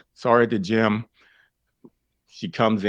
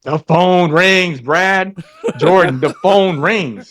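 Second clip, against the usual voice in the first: loud, slow, drawn-out "the phone rings"; emphatic punchline; 10 semitones higher, 10 dB louder, slower than usual. Real, not acted. Telling hosts the twist, loud and slow. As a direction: hammer each word home slowly.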